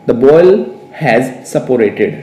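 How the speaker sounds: slow, deliberate English for learners; measured dictation pace with small pauses; slow model sentence. Shown as a demonstration. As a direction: spell it out word by word, teacher-style. Teacher models sentence for learners to repeat.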